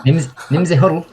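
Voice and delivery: a touch of Scottish lilt on "Nimza Huddle"; mock Scottish name; Scottish accent. Shown as a demonstration. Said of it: "my attempt at a Scottish accent".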